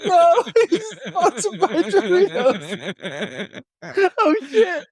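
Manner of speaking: high, slow, laughing till tears; gasping out words through laughter; helpless laughing fit; crying with laughter. Real, not acted. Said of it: "I had a full tear down my face".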